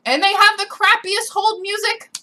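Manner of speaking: worked-up griping; exasperated complaint. Real, not acted. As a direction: complain loudly at a high pitch. Complaining about hold music.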